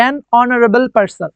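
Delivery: an honorable said very slowly, starting on a vowel with no h; silent h demo. Shown as a demonstration. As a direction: model an honorable for the class, h dropped. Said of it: "অনারেবল, এখানে আমরা অ".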